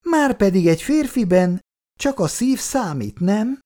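high, loud biting retort; sting like an angry wasp. Acted. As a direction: maid hitting back sharply. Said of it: "úgy csípett, mint egy mérges darázs".

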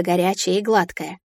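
ordinary delivery

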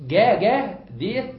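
جا said loud and slow with a hard g; جا punched with a hard g; hard g, emphatic. Shown as a demonstration. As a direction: hit the hard g in جا. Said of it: "هذا الصوت مركب".

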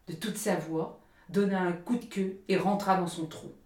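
ordinary delivery

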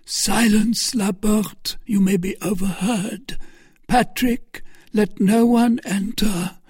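high, strained, failing speech; pained high voice, slowing at the end; dying voice. A narrator's reading. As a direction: speak as if mortally wounded. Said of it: "said the Duke in a dying voice".

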